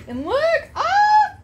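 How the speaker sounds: high, loud squeal of delight; excited squeal. Real, not acted. Mother showing her daughter a new present, squealing. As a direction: shriek happily at the find. Finds more gifts.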